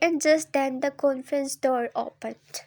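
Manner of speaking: plain reading voice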